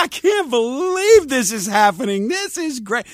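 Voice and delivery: high, delighted gasp of disbelief; happy disbelief. Acted. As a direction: sing out the joy you can't believe. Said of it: "you're ha- (happy)".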